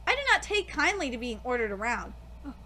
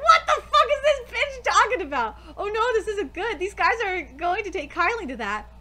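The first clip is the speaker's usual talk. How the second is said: incredulous onlookers; 4 semitones higher, 5 dB louder than usual. Retold or imitated. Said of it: "They're like".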